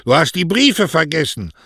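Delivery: high, loud call; calling after him. A narrator's reading. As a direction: shout it down the street. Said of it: "hinter Mario herrief".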